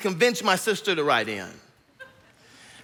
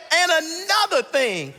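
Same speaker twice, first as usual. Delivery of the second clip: high, drawn-out mock yell; dad yelling; 9 semitones higher, slower than usual. Country dad carries on his tirade at his kids, yelling. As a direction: stretch it out like a dad hollering on. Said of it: "he starts yelling at us".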